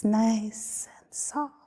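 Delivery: slow, soft whispered release. Acted. Whispered after release count.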